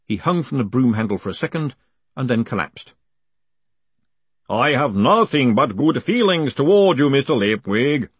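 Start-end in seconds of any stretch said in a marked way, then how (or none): 4.5-8.1 booming, cheerful goodwill. A narrator's reading. Golem greeting the man it just tripped, cheerfully booming. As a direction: boom it out loud and high, cheerfully. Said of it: "boomed the voice cheerfully".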